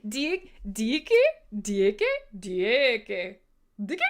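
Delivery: Dicky tried several ways, some drawn out; butchered name. Real, not acted. Host guessing a new viewer's name, playfully mangled Dicky. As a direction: experiment with Dicky, stretching it, then questioning. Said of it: "I completely butcher your name on air".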